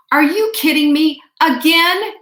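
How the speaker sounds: the wife snaps in disbelief at the neighbor passing again; exasperated. Retold or imitated. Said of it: "Just exactly like that".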